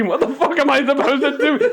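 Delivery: high, loud petulant complaint; the diner fed up with the eggs; mock indignant whine.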